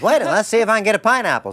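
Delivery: high, loud excited mimic of Michael; loud, high-pitched imitation of an excited friend; imitating Michael. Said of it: "So Michael goes".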